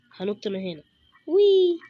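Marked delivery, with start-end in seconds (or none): 1.3-1.8 high, loud "وي" as you leap; thrilled leap cry; excited whoop. Real, not acted. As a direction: whoop on the jump. Jumping down in the game.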